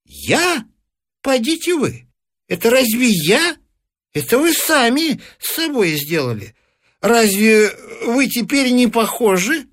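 put-on high, scoffing old man; old man denies blame to the couple, high; mock-innocent old man. A narrator's reading. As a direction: brush off the blame, high and sly.

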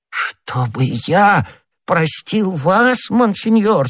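high, drawn-out fawning voice; humble petitioner overawed by His Eminence; подобострастный Бонасье. Acted. Figure of Bonacieux, servile. (высокий подобострастный голос)

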